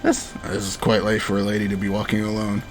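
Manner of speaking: low and soft gentleman catboy; deep boy voice. Acted. Streamer voicing Luke, low and soft. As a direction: murmur it low like a suave boy. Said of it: "I'm killing my voice".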